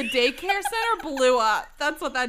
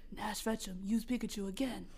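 low, hushed inner voice; mysterious voice; 6 semitones lower, 11 dB quieter than usual. Acted. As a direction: speak softly and low, like an unseen guide. The voice speaks to the hero.

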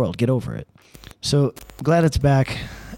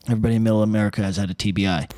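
ordinary talk

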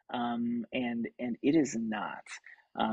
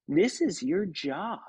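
imitating Kate; 4 semitones higher, 3 dB louder, slower than usual. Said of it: "and she goes".

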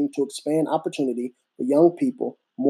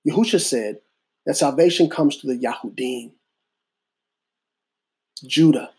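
preaching. Acted. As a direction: deliver it like a sermon. Slow sermon with long pauses.

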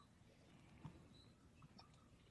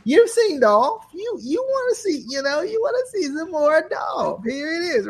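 hype announcer; 14 semitones higher than usual. Pretend. Hyping Dolph like announcer.

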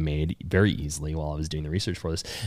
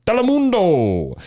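announcer voice; 12 semitones higher, 5 dB louder, slower than usual. Acted. Drawn-out mock announcer name.

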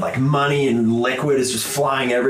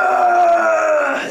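high, loud roaring cheer; crowd cheer; 6 dB louder than usual. Acted. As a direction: yell like a wild crowd. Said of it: "And the crowd's like".

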